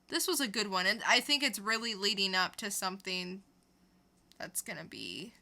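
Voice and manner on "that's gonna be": hushed build-up whisper; dramatic whisper. Acted. Reviewer whispers the lead-in to a big word.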